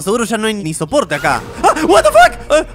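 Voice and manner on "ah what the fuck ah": high startled scream breaking into a laugh; shocked shriek. Real, not acted. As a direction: yelp in real fright, then laugh. Jump scare by the game monster.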